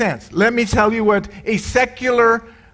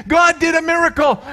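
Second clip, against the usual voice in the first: GOD shouted high and excited; thrilled cry that God did it; 8 semitones higher than usual. Retold or imitated. Diane rushing up excited.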